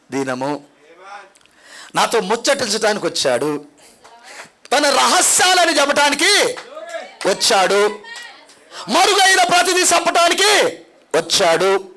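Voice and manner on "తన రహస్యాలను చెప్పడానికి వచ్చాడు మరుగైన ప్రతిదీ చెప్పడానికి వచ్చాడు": higher on each clause; rising sermon lines; preacher's build. Acted. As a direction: lift the phrases, preaching.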